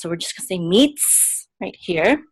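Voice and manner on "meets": meets as one syllable, no vowel after the ts; silent sheva. Shown as a demonstration. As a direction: end meets on a held ts, adding no vowel. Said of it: "the shava in the middle of a word is not pronounced".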